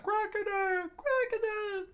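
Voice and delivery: very high falsetto fan squeal; high-pitched mock crowd cry; crowd screaming. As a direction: chant the name like a shrieking fan. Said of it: "As the crowd screamed out".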